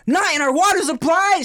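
high, loud mock-outraged shout; outraged yell. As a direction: blurt it out like a furious camp mate. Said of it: "the guy's like".

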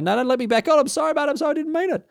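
high-pitched begging, mock-desperate; desperate pleading. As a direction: plead frantically as the ship pulls away. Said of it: "bloody saying".

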